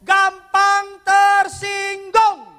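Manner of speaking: preacher's slow, loud cry; shouted slow. Acted. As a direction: hammer the point to the crowd. Preacher stressing key point.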